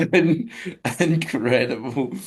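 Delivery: slow, broken by real laughter; amused disbelief. Real, not acted. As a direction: savour it through the last laughs. Recovering from laughing at the mistake.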